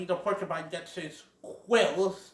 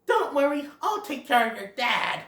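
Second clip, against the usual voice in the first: raised, confident little helper voice; Thumper; 9 semitones higher than usual. Acted. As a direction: reassure a friend, pitched up. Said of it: "Thumper goes".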